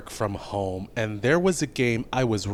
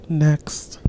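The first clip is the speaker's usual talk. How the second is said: whisper; 4 semitones higher than usual. Real, not acted. Host moving on, whispered.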